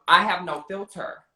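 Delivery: ordinary delivery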